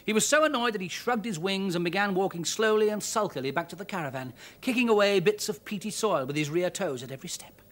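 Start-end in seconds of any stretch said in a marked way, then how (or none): none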